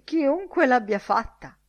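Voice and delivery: put-on loud, high-pitched drunken protest; indignant drunk. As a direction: finish the punchline as the affronted drunk. Drunk's punchline in custodian's anecdote.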